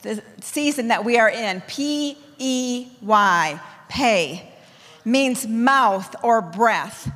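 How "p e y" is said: "P E Y" spelled letter by letter, slowly. Shown as a demonstration. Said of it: "emphasis on the word pay".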